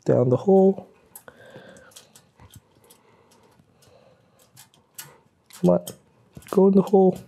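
high coaxing plea; threading a needle. Real, not acted. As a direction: coax the part in, high-pitched. Coaxing a T-nut into hole.

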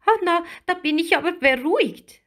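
put-on high, quick small-girl voice; fast, high-pitched relieved chatter; relieved little mermaid. A narrator's reading. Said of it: "sagte Lilli".

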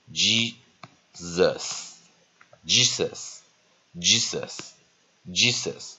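Jesus said slowly with a long "ee"; slow model pronunciation. Shown as a demonstration. Teacher drilling a new word, slow, careful Jesus. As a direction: repeat Jesus slowly, pausing between each. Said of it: "E拉成长母音G".

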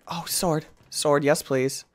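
ordinary delivery

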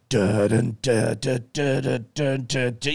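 a mock ominous TV score hummed out as syllables; moody music imitation. Imitating the moody background music put over her exit. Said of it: "the music goes".

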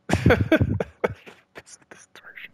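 slow, breathy whisper with mock awe; mock dramatic whisper. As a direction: hush it out slowly as if ominous. Echoing the narrator's dramatic line.

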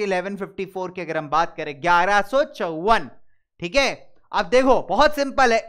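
ordinary talk, nothing marked

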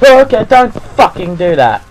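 high, loud shaken protest; loud frightened scolding; startled shout. Real, not acted. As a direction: yell out at a jump scare. Jump scare interrupts his talk.

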